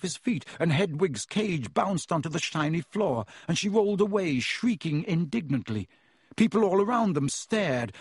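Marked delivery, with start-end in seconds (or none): none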